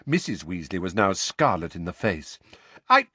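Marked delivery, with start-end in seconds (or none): 2.9-3.1 high, loud, exasperated mother; exasperated, raised-voice concession; flustered surrender. A narrator's reading. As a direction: give in crossly, breaking off at first.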